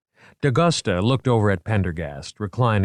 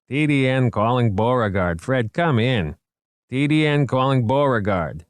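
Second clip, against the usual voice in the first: lightly voiced dispatcher, a little higher; radio dispatcher; 5 semitones higher than usual. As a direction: call your man in over the radio.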